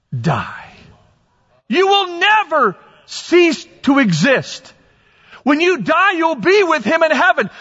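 full-force sermon shout, slowed on key words; fervent preaching. Acted. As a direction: preach it out to the back row.

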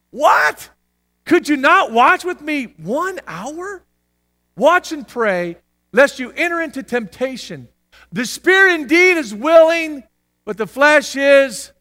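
slow, loud, high admonishment. Acted. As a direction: admonish with drawn-out emphasis.

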